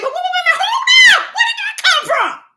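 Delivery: high, loud cry of surprise breaking in; stunned high-pitched shout of wait, hold up; startled shout. Retold or imitated. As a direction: shout it like you just caught yourself off guard. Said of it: "And I'm sitting here saying".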